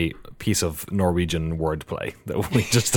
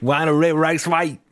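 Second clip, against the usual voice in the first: put-on Australian accent; 6 semitones higher than usual. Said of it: "in an Australian accent".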